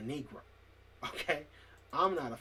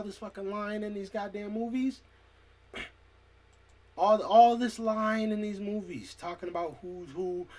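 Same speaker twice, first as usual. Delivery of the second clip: angry rant; 6 semitones higher, 6 dB louder than usual. Real, not acted. Ranting about lies in movies.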